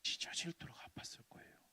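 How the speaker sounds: hushed whispered sorrow. Acted. Preacher on God's heartbreak, whispered. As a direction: say it softly, breath only.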